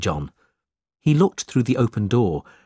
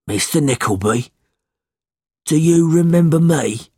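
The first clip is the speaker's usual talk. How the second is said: put-on old man's voice, rising louder; 5 semitones higher, 5 dB louder than usual. A narrator's reading. Bent old man hailing Ralph, in an old man's voice. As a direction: call out as a needy old man. Old beggar hails his former employer.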